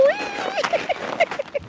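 high gleeful squeal sliding down; excited squeal. Real, not acted. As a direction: let out a thrilled whee. Sliding down hill on butt.

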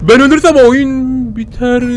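high gasp, then slow dread; gasp, then say it slowly in alarm. Real, not acted. Gamer realizing everything depends on him, slow dread.

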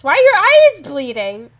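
shocked by the bleeding eyes; horrified question. Real, not acted. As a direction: recoil in horror as you ask. Horrified at game scene.

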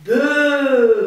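drawn-out cow moo, loud. Acted. Teacher reading to children, mooing as the cow. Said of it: "zegt de koe".

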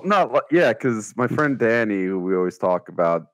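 ordinary talk, nothing marked

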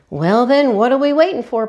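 rally the family to go; eager shout. A narrator's reading. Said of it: "Papa shouted".

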